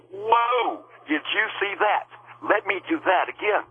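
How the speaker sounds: thrilled astonishment in retelling; retold amazement. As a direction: marvel at it, pitch leaping up.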